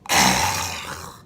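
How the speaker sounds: loud exasperated sigh; real disgusted sigh; heavy groaning sigh. Real, not acted. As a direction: sigh like it ruined your night. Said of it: "exasperated at Mojo".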